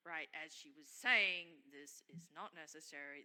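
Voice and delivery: ordinary talk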